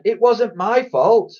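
high, slow protest of innocence; drawn-out boyish plea; pleading. A narrator's reading. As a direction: plead like a wronged little boy. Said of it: "pleaded Ned".

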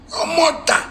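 sudden high-pitched jab; your mother, squeaked high; insult outburst. Real, not acted.

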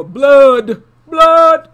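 loud, high, drawn-out bellow; hammed-up cry, stretched out and belted; mock horror howl. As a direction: howl it like a campy horror trailer. Hammed-up horror retelling, villain's craving.

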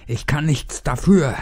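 high, loud, desperate cry; desperate scream. A narrator's reading. Fat man screams his innocence after the attack. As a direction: scream it out, beside yourself. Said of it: "schrie er immer wieder".

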